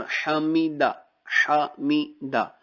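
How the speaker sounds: حمیدہ said very slowly with a clear throaty ح; clear ha demo. Shown as a demonstration. Teacher drills حمیدہ so the student hears the ح. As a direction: stretch حمیدہ and sound its ح from the throat. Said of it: "ہا کو، آ، ضروری نکالنا یہاں پہ ضروری ہے".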